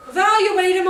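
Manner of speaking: high, loud, urgent cries; hammed-up alarm, high and loud; mock frantic shouting. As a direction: shout it like a panicked crowd of adults. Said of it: "everybody's like".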